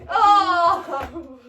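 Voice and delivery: loud, drawn-out oh; laughing fight-crowd holler; whooping reaction to a blow. Acted.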